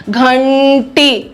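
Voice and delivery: घंटी drawn out slowly on a held retroflex n; slow teaching pronunciation of घंटी, n lengthened; nasal sound before the consonant. Shown as a demonstration. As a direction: hold the n in घंटी, tongue curled back, before टी. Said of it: "आपका न अनुस्वार".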